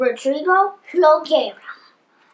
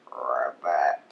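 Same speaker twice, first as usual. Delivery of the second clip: very low, soft rattling ribbit; low frog croak; 10 dB quieter than usual. A narrator's reading. Little pig sits practicing his ribbit, low soft croak. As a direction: rumble a quiet croak.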